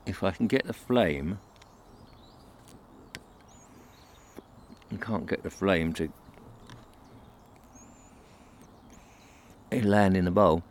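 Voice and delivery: talking around a pipe stem while lighting it; words muffled by a pipe in mouth. Real, not acted. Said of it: "I'm just lighting my pipe".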